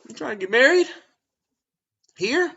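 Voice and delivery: high, loud teasing line; playful flirty tease. Retold or imitated. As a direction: tease her like you're smitten. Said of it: "I was like".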